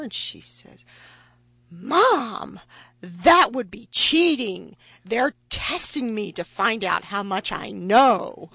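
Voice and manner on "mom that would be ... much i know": indignant daughter. Retold or imitated. Daughter quoted protesting to mom.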